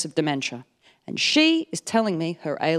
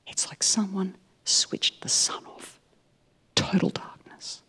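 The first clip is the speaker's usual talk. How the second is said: eerie whisper; 6 dB quieter, slower than usual. Retold or imitated. Grandma tells of the darkness.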